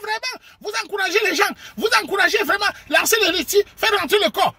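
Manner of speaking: high shouted mock accusation. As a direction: yell it like the crowd blaming you. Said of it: "on va dire".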